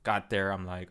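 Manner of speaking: usual speaking voice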